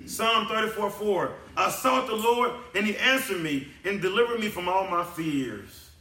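high, loud sermon climax; impassioned preacher in full cry; full-force preaching. Real, not acted. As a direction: preach it loud, pitched well up.

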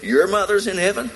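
incredulous question pitched high; shocked disbelief. Retold or imitated. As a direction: ask it as if you can't believe your ears. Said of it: "She said".

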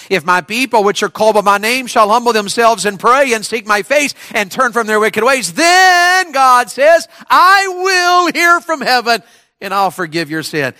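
high preaching voice building to a shout; declaimed scripture. Acted. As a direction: proclaim God's promise, peaking on "then". Declaims scripture at full force.

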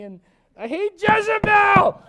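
high, drawn-out mock yell; loud, slow, exaggerated tantrum wail; mock outburst. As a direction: explode into a hammed-up shout. Elijah's moping boils over, acted.

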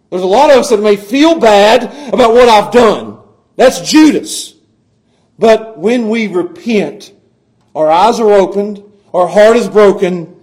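loud, high, slowing preacher's cry; fervent sermon climax, voice cracking with feeling; impassioned preaching. Acted. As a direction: preach it at full force, pleading. Preaching on repentance.

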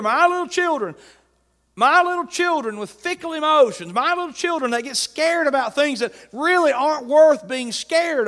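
preaching at full force. Real, not acted. Preacher to congregation, rising fervent cry. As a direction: drive the refrain home to the church. Building sermon climax.

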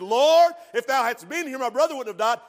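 Martha, hurt and accusing; confrontational. Retold or imitated. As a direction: Lord pushed out loud and high, accusing. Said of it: "A little confrontational".

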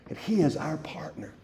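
hushed whispered aside; whispered reverent aside. Real, not acted. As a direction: drop to a breathy whisper for emphasis. Pastor drops to whispered aside.

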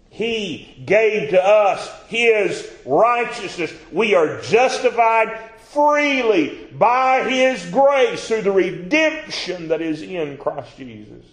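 high, loud, slow scripture reading; preacher reading Romans at full force; preaching scripture. Real, not acted. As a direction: proclaim the verse slowly. Preaching peak.

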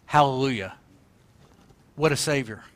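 low, subdued hallelujah; soft, solemn and restrained; serious tone. Real, not acted. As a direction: say the praise gently, held inside. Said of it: "We've cut a pretty serious tone today".

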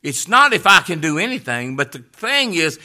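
loud, high, driving sermon cry; preaching at full force. Acted. Pastor pressing the congregation toward faith, loud preaching. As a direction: lift the whole room.

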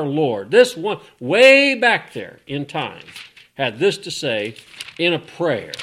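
loud, ringing sermon delivery; full-voiced preacher; fiery preaching. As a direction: preach it out to the back row.

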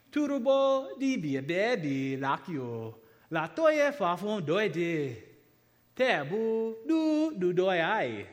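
slow, careful reading in the Tutor tonal language; reading Tutor aloud. Shown as a demonstration. Missionary reading Tutor text aloud to the congregation, slow and careful. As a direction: read each syllable slowly with its tone. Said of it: "I'm gonna read this to you".

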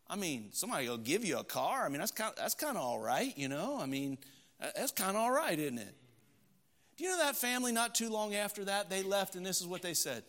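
ordinary delivery

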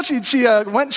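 ordinary talk

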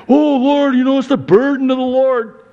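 a believer complaining to God that the burden is heavy; whiny believer. Acted. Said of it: "what are you doing".

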